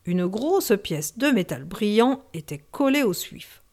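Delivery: ordinary delivery, nothing marked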